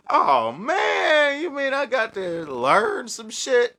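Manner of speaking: high whiny mock of a disappointed student. Mocking students who expected instant magic, high whiny voice. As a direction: whine like a lazy beginner.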